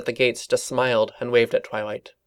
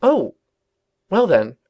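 taken aback; 8 semitones higher, 6 dB louder than usual. Acted.

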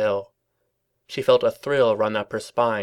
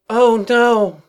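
high, loud hammed-up wail; 12 semitones higher, 8 dB louder than usual. A narrator's reading. Twilight faking a faint before her office colleagues, wailing. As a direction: fake a dramatic wail. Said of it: "she wailed".

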